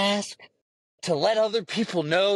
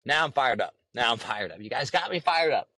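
ordinary delivery

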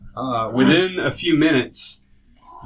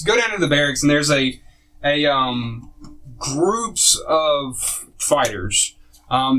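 ordinary talk, nothing marked

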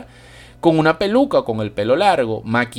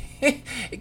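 incredulous laugh; 10 semitones higher, 6 dB quieter than usual. Real, not acted. Laughs at imagined claim.

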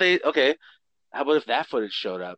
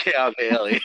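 ordinary delivery

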